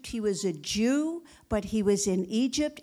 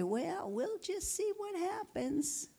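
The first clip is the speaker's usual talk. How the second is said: mock complacent shrug; 5 semitones higher, 10 dB quieter, slower than usual. Mocking a passive churchgoer.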